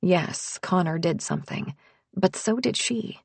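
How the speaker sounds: ordinary delivery, nothing marked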